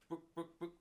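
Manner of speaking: high clucking hen going book book book; chicken clucking. Acted.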